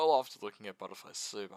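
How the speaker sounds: usual speaking voice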